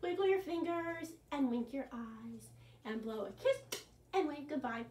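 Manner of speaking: ordinary delivery, nothing marked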